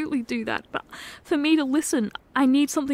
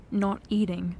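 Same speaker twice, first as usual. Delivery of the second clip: ordinary talk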